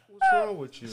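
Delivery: loud, high incredulous outburst; mock outrage at the situation. Dad mocking himself at a freezing game, incredulous.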